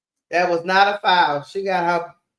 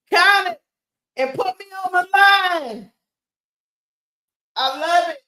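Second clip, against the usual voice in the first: real thrill, shouting count it; excited fan eruption; 7 semitones higher, 4 dB louder, slower than usual. Real, not acted. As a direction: voice jumps high and loud at the and-one. Fan erupts at and-one play.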